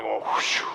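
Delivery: low, airy whoosh of a ball in flight; imitated whoosh. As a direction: mimic a long shot sailing off.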